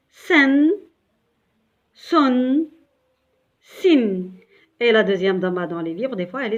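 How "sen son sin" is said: sen, son, sin read again with a clear n. Shown as a demonstration. Teacher rereading the tanwin syllables for the class. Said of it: "Je relis".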